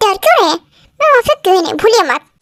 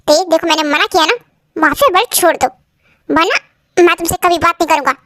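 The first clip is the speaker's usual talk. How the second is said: ordinary talk, nothing marked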